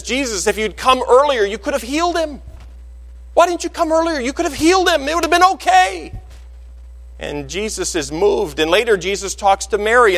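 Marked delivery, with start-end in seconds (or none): 0.0-6.1 high, pleading reproach; a sister asking why he came too late; grieving Martha. Acted. Martha reproaching Jesus at the tomb, high and pleading. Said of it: "Martha, the sister of Lazarus, says".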